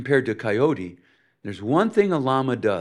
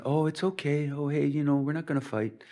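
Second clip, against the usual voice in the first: higher, mild and placating imitation; gently smooth things over, pitched up; mock conciliatory Minnesotan; Minnesota nice; 4 semitones higher, 5 dB quieter than usual. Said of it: "it's called Minnesota nice".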